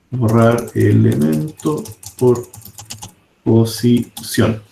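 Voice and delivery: slow, spelled-out dictation of code; dictating slowly. Shown as a demonstration. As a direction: say each word slowly as if typing it. (dictando muy despacio)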